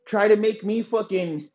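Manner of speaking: ordinary talk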